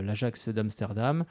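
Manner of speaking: usual speaking voice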